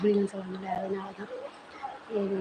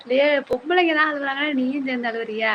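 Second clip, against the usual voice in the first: mother's loud, higher-pitched teasing scold; loud chiding; 5 semitones higher, 8 dB louder than usual. Real, not acted. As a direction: chide your son loudly for crying at a drama. Mother chides son over TV tears.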